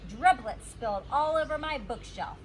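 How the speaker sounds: ordinary delivery, nothing marked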